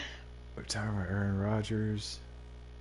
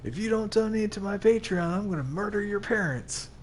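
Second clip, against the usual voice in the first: YouTuber voice; 10 semitones higher than usual. Pretend. YouTuber threat parody.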